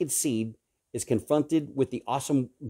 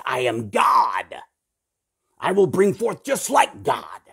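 slow, loud mocking voice of a proud scientist; preacher mocking scientists who claim to be God; mock arrogant scientist; 5 semitones higher, 6 dB louder, slower than usual. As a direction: sneer it out as a boastful scientist playing God.

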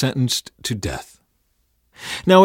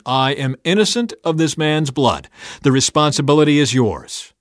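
higher, raised public voice; firm declaration; 5 semitones higher, 5 dB louder than usual. A narrator's reading. Pilate washing his hands before the crowd, firm declaration.

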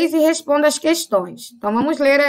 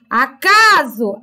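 "acaso" drawn out slowly with a strong, long CA; slow "aCAAso", stress on the middle syllable; calling the word; much slower than usual. Shown as a demonstration. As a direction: call out "acaso", leaning hard on CA. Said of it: "Chama a palavra".

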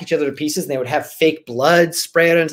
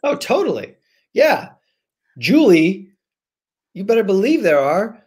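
much higher, eager affirmation; excited yes; 5 semitones higher than usual. Real, not acted. Author answering the host's question, eager and bright. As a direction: burst in with delight.

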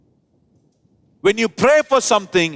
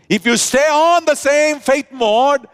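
full-force preaching; 6 semitones higher than usual. Real, not acted. Preacher exhorting the church to hold faith, high forceful preaching. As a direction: lift it up and drive it home.

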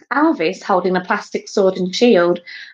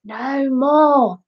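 raised cue from the piano; feed the actor his missing words; helpful stage prompt; teacher prompting; 6 semitones higher than usual. A narrator's reading. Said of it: "Mister Lace prompted".

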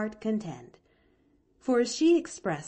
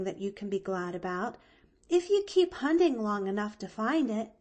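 put-on high, eager girl, quick in bursts; earnest little girl chattering; cheerful young Pollyanna; 4 semitones higher, 4 dB louder than usual. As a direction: explain brightly in a high girl's voice.

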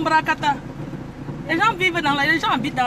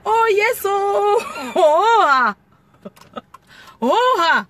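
high, loud, crying oh yes; wailing whoop; 7 semitones higher, 4 dB louder, much slower than usual. Acted. Driver wailing along to the baronne song, mock crying. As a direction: wail it out like a heartbroken singer.

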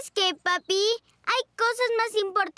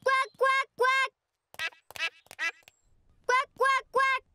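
duck quacking; 3 semitones higher than usual. Pretend. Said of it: "¿Quién sabe graznar como pato?".

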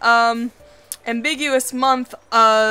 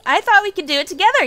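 rushed, high and bubbling over; words tumbling out fast; fast bubbly chatter; 8 semitones higher, 4 dB louder, faster than usual. Acted. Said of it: "She spoke quickly, words bubbling".

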